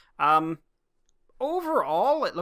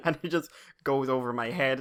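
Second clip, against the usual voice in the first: normal talking voice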